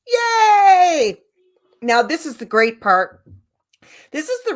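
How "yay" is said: loud high yay; joyful whoop; cheer. Real, not acted. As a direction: shout it out happily. Cheering after the chant.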